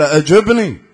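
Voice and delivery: sudden loud shout of command; demanding shout. Retold or imitated. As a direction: bark the order at him. Imam demands an answer. (صيحة آمرة مفاجئة)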